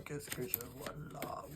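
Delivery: whispered, soft and secretive; mystic whisper. Pretend. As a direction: breathe the question like a seer. Repeats in whisper. (chuchotement de voyante)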